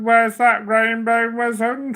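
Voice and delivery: usual speaking voice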